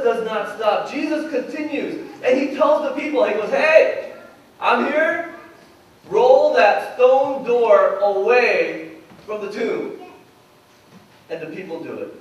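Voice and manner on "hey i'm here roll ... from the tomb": loud, drawn-out order; raised voice, slow firm command; commanding call. Retold or imitated. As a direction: call out to the crowd with authority. Jesus commands the people at tomb.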